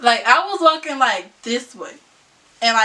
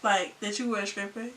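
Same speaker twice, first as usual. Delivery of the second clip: much softer, hurt confrontation; hurt quiet; 3 semitones lower, 10 dB quieter than usual. Retold or imitated. To boyfriend who hid his job, soft hurt accusation. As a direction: let it come out low and wounded. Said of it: "I was like".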